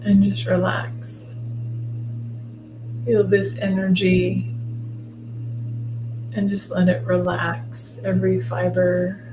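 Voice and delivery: soft, slow guided meditation voice; right in your ear. Acted. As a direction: ease them into calm, gently and slowly. Leads a guided visualization.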